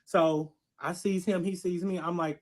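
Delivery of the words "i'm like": ordinary delivery, nothing marked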